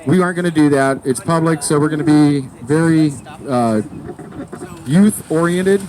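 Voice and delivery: ordinary delivery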